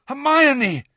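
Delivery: high cry of a name, falling at the end; alarmed cry. A narrator's reading. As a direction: call out to a fallen friend. Harry calls to fallen friend.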